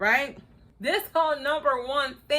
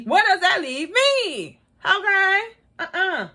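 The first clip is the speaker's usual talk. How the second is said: mock indignant; 8 semitones higher, 4 dB louder than usual. Mock outrage at show.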